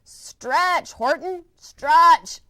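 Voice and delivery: loud, high yell; yelling bug. Acted. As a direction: shout him into stretching. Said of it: "yelled the bug".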